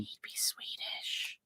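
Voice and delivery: a slow, breathy whispered aside. Real, not acted. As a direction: whisper it like a secret jab. Teasing aside to co-host.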